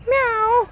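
playful, high meow aimed at the cat; high mock meow. Owner meowing to a stray kitty, high mock meow.

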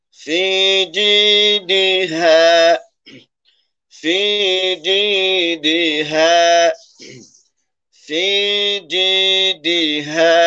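slow, high chanted في جيدها; recited word demo. Shown as a demonstration. Teacher recites the phrase slowly for students. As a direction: draw it out like Quran recitation. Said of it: "এবার আমরা মিলাই শব্দ".

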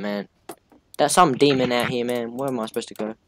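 ordinary talk, nothing marked

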